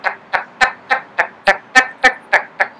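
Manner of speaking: clipped tak tak rhythm sounds; taka taka accent demo. Shown as a demonstration. Vlogger mocking the Indian accent rhythm, clipped taps. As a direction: tap out tak tak tak to mimic the accent. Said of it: "I like to call it the taka taka accent".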